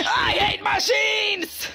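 Shrimpo. Acted. Angry shrimp at the machines, hammed-up furious yell. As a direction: yell with cartoon hatred. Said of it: "play as this little angry shrimp".

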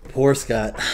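ribbing pity for the husband. Real, not acted. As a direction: call it out, playfully sorry. Repeated sympathy.

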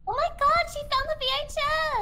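high excited squeal; thrilled, voice jumps up. Real, not acted. Excited discovery in game.

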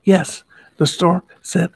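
plain reading voice

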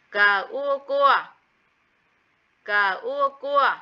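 đánh vần "Cờ ua cua" thật chậm; kéo dài "Cờ", "ua", rồi ghép thành "cua"; slow spelling out. Shown as a demonstration. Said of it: "Tiếng cua". (đánh vần chậm rãi "Cờ ua cua")

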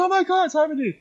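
very high, gushing mock squeal; excited recognition. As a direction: act out thrilled recognition of someone. Retold delighted recognition on meeting.

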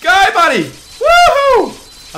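loud high urging shouts; excited cheering. Real, not acted. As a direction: cheer the ball home. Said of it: "I'm screaming so much".

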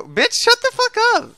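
very high squeal of annoyance; shrieky retort. Real, not acted. Gamer telling a teammate off, high-pitched squeal.

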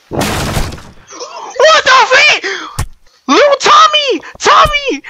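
high, drawn-out wailing shout; anguished cry. Real, not acted. Gamer finding Tommy dead in the game, high anguished wail. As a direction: wail over the fallen friend.

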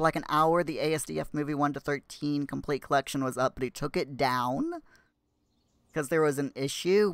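usual speaking voice

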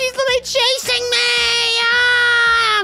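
real panicked yelp; scared shriek. Real, not acted. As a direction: scream as the rat chases you. Rat right behind her.

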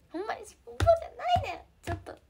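high, loud burst of protest; emphatic protest. Real, not acted. Protests emphatically to teasing viewers.

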